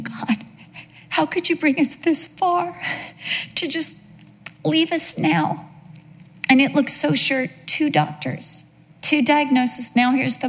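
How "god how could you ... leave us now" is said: soft, high, broken plea; retelling a despairing plea to God; anguished prayer to God. Retold or imitated. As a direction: cry out to God from the floor. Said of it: "just saying".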